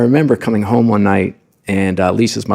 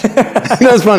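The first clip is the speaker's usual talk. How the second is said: laughing; 11 semitones higher, 4 dB louder, slower than usual. Real, not acted. Laughs at own story.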